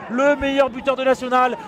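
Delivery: ordinary talk, nothing marked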